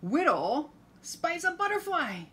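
high, loud storybook voice; excited read-aloud for little ones; reading to a toddler. Acted.

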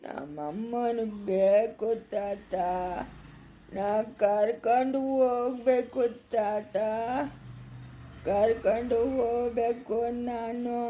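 very slow, soft, eerie spirit voice; faint, drawn-out ghostly demand; ghostly child. Acted. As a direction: stretch each word like a haunting. Dead grandson's spirit demands his mother.

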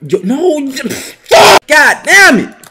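high, loud anguished cry; frustrated yell. Real, not acted. Gamer losing his court, frustrated yell. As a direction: lose it as the court slips away.

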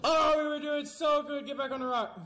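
high shout, then a plea; anguished shout. Real, not acted. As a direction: shout it out as it all falls apart. After big fall.

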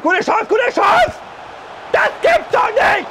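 slow high shouts; excited shout. Real, not acted. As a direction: yell in disbelief. Missed chance in the game.

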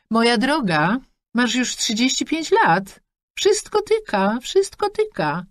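high, chatty mother rattling off wishes; a pushy mum beaming down the line; mother on the phone. Acted. Mother lectures about age.

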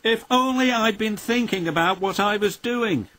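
a hint of a sorry young engine; lightly voiced Thomas. A narrator's reading. Thomas regretting his mess, regretful.

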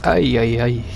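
ordinary talk

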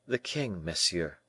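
soft, subdued sad reply; quiet resignation; sadly. A narrator's reading. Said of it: "sadly replied the young man".

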